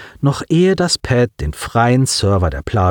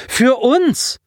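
insistent. Acted. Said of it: "setzte er nach".

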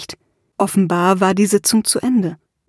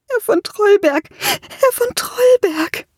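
much higher, calling out, sobbing, slowing; desperate cry; 13 semitones higher, slower than usual. A narrator's reading. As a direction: therapist crying to the troll for help. Therapist cries for troll's help.

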